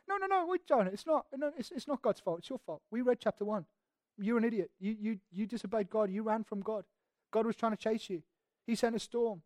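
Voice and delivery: soft, dry correction aside; acted objection. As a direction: quietly set Jonah straight. Preacher answers back to blaming Jonah.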